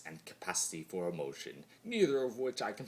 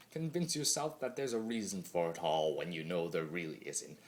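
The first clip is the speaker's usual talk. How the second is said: usual speaking voice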